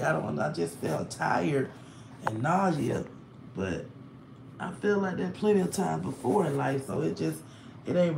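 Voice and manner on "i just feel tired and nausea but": normal talking voice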